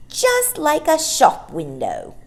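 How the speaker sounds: high, slow admiring exclamation; housemaid Ellen. Acted. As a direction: gush it like a maid admiring. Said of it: "as Ellen the housemaid said".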